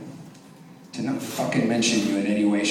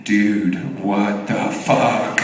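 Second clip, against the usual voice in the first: slow, lower, mock-appalled deadpan; stunned buddy; 4 semitones lower, much slower than usual. Buddy coming up after church, slow stunned disbelief. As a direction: draw it out in stunned disbelief, imitated.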